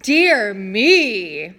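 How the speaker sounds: loud mock surprise; Mister D feigning surprise at the wine, loud; feigned surprise. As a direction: fake being startled. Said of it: "feigned surprise".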